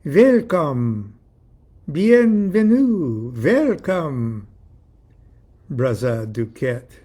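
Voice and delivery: put-on loud European-accented greeting, drawn out; hammed-up European accent, word by word; pretentious European welcome with pauses; affected European accent. A narrator's reading. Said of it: "embarrassingly affected European accent".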